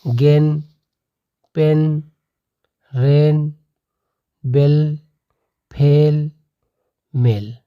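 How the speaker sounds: गेन, पेन, रेन with a clear long e, each word apart; example words read out. Shown as a demonstration. Teacher reading the AI examples, clearly articulated. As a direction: drill गेन पेन रेन बेल फेल मेल one by one. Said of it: "जैसे आपका दिया है".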